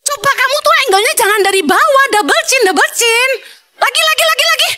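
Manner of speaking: high, bossy photo orders; bossy wife directing. Retold or imitated. Wife ordering husband to retake photos, high bossy. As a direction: boss him around like a fussy model.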